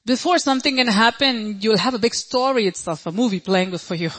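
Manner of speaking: ordinary talk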